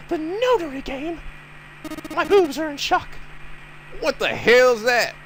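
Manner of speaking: put-on high, slow shocked girl. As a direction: gasp it like a ditzy girl.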